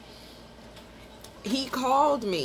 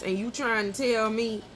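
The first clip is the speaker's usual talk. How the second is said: usual speaking voice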